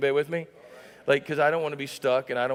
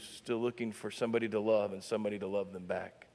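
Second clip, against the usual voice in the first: soft, low, unhurried sincere sermon voice; tender preaching; 5 semitones lower, 5 dB quieter than usual. Acted. Pastor gently comforting the hurting, soft and low. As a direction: bring it down and speak to their hurt.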